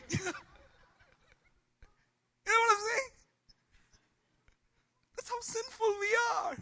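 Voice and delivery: high, loud shouted punchline; preacher's shout. Real, not acted. Pastor shouting to the congregation how sinful people are, high and loud. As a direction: let it burst out in a shout. Laughing, then shouting the point.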